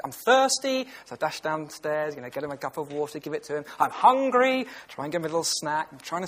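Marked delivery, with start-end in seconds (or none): 0.0-0.9 put-on toddler voice. Voicing the toddler's demands. 3.8-4.6 put-on toddler voice. Voicing the toddler's demands.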